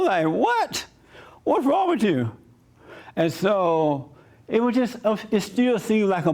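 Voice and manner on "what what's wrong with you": disbelief. Retold or imitated. Retold shock at being thrown out.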